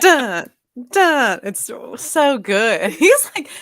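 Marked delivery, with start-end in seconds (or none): 0.0-1.4 high, loud sung-out orchestra stab; imitated orchestral hit. Mimicking the score as Bond beats the spider, dramatic stab. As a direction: punch it like a film-score sting. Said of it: "there were orchestral beats that hit".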